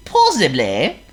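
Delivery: very high squeaky worm. Acted.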